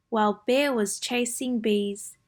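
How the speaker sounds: usual speaking voice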